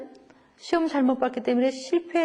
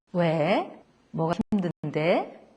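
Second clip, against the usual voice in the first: gentle asking; 6 semitones lower, much slower than usual. Shown as a demonstration. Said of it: "그러면서 그 얘기를 들어주는 거예요".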